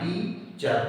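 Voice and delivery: "Teacher" slowly as टी, pause, चर; split in two syllables. Shown as a demonstration. Teacher showing the dash in the word, "teacher" split in two. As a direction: break "teacher" into two beats. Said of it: "इसका उच्चारण दो बार".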